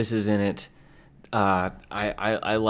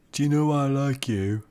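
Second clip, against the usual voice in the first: a higher, botched Liverpool accent try; attempted Liverpool accent; 5 semitones higher than usual. Shown as a demonstration. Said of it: "No, that's not good".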